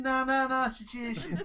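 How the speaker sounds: high, loud mock Northern Irish sing-song "situation"; mock the Northern Irish "situation" with a laugh; mocking Northern Irish accent. Mocking the Northern Irish way of saying situation, high and loud. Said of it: "They always say situation".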